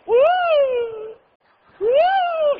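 very high, loud uh shouts; a high, loud shouting worshipper, imitated; mock grunting shout. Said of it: "había uno que hacía".

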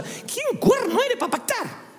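high, slow indignant challenge; mock outrage. Preacher scolding believers who make pacts with God. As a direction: scoff at them in disbelief.

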